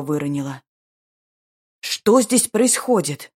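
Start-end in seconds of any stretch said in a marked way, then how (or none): 1.8-3.3 loud, slow, furious demand. A narrator's reading. Brother catching his sister, furious demand. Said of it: "Яростно потребовал ответов брат".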